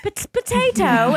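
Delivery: put-on Pot-, Potato in a high, laughing British child's voice; British kid accent. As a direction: mimic the kids saying Potato, Pot-, Potato, high and cute. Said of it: "they have the cutest accents".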